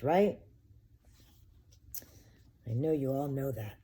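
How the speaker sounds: ordinary delivery, nothing marked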